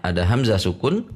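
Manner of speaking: ordinary talk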